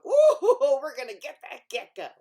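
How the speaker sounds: loud laughing glee; a cat and dog laughing at prey; gloating animals. Acted.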